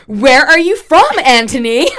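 loud, rising, put-on American accent; [name]. Quoting his first podcast title, mock American accent. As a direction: ask it loudly in a bad American accent. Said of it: "in this dreadful accent".

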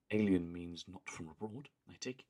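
lightly imitating a calm posh deadpan; Joan's flat deadpan. Imitating how Joan says it to the boys' teacher, mild deadpan. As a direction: say it matter-of-fact, a hint of posh. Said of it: "whereas she's just like".

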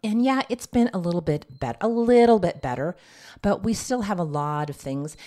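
ordinary talk

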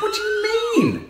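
high, slow, shouted disbelief; incredulous shout. Real, not acted. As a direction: yell it in mock outrage. Indignant laughing protest.

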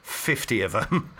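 ordinary delivery

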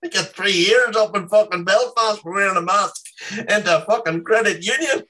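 mock outrage through laughter; incredulous laughing rant. Real, not acted. Outraged joke about mask arrest.

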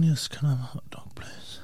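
breathy timid whisper; whispered sensitive voice. Retold or imitated. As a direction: whisper the order like a fragile artist. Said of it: "That's how they speak".

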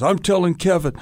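mock-childish tattle to the director; tattling. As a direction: say it like a kid running to tell. Childish rivalry over a role.

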